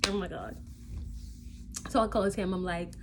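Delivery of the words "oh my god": whispery breathy laugh. Real, not acted. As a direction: laugh it out under your breath. Laughs at the memory.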